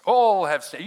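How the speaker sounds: All punched loud and high; stress on ALL. Retold or imitated. Pastor showing the emphasis he was taught, stress on ALL. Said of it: "And that was the emphasis".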